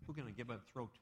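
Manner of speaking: usual speaking voice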